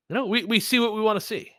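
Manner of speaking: normal talking voice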